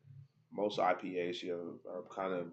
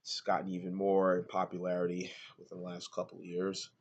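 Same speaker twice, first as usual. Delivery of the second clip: normal talking voice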